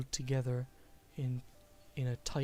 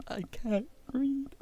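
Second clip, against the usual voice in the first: mock self-mockery; 12 semitones higher, slower than usual. Stumbling over a big word.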